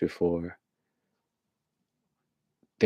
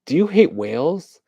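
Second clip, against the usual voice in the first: higher, louder pitch to a passerby; mock petition canvasser; 7 semitones higher, 5 dB louder than usual. Petitioner stopping a stranger about whales, mock canvassing. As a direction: call out like an eager canvasser.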